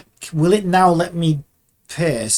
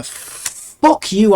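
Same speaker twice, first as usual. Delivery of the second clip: loud, raised curse at the software; really mad; 6 semitones higher, 6 dB louder than usual. Real, not acted. Animator cursing the animation software, mad. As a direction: spit the curse out at the machine. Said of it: "I'm so fucking mad right now".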